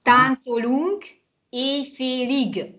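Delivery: "Táncolunk éjfélig" slowly, word by word; teacher's careful slow modelling; slow model phrase. Shown as a demonstration. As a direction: stretch out "Táncolunk éjfélig" for learners.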